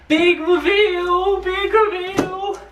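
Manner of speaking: high, slow showman announcing; grand reveal voice. Pretend. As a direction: hype it like a game show host. Mock announcing the delivery.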